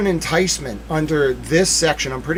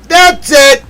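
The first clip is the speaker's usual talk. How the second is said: very high, loud squeal; triumphant shriek; 12 semitones higher, 12 dB louder than usual. Real, not acted. Streamer to chat, squealing in victory. Second take higher.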